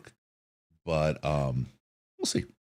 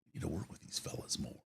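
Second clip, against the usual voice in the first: whispered quote; 14 dB quieter than usual. Retold or imitated. Quoting the station bosses.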